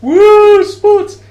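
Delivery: very high, loud whoop; over-the-top fake crowd cheer; mock sports cheer. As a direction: whoop like a clueless sports fan. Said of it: "guys are just like".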